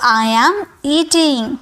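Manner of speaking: "I am eating" drawn out slowly, word by word; slow model sentence. Shown as a demonstration. Teacher shows the class how to say it, slowly. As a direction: say "I am eating" slowly so students can copy. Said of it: "क्या लिखा हुआ है?".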